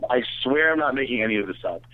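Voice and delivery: ordinary talk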